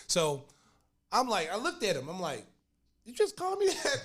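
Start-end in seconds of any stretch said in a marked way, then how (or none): 3.1-4.0 high-pitched incredulous question; retold disbelief. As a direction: play up being shocked by the insult. Retold disbelief at being insulted.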